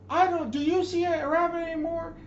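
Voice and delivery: barked shout, way up high and loud; high, loud drill instructor shout; drill instructor yell. Retold or imitated.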